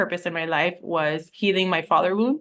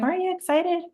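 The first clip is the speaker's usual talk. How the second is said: much higher, a bubbly imitated question; mock excited friends; 11 semitones higher than usual. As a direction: imitate an eager friend nudging her. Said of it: "They're like".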